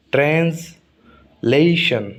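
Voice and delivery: Translation very slowly, split as trans, then lesson; syllable by syllable. Shown as a demonstration. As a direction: break translation apart for learners, "ले" drawn out. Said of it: "इसमें तीन syllable हैं". (Translation को तोड़कर, बहुत धीरे)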